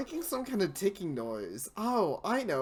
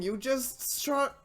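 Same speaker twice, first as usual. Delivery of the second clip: scolding; 7 semitones higher, 7 dB louder, slower than usual. Real, not acted. Scolding the character.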